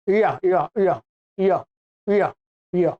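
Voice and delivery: low, separate repeats of "य"; palatal Y demo. Shown as a demonstration. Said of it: "य का उच्चारण भी इसी से तालु से". ("य" का तालव्य उच्चारण, नीचे सुर में)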